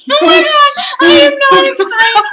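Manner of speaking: high, loud laughing yelp; laughing shout. Real, not acted. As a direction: shriek with nervous laughter. Dreading the skunk jelly bean.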